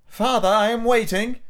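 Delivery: put-on high-pitched, impatient little boy; cold child. A narrator's reading. Son waits for father's turn, coldly. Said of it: "said Michael coldly".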